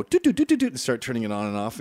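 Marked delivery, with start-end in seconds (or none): 0.0-0.7 high, loud, rapid doots; imitated switch flicking. Kid playing with a light switch, high staccato noises. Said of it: "and then you go".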